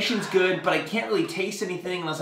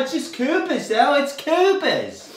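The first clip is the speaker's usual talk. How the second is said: high, drawn-out mock Australian; Australian accent; 9 semitones higher, 3 dB louder, slower than usual. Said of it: "as [name]'s Australian accent".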